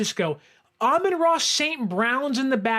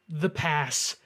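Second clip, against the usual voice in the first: ordinary talk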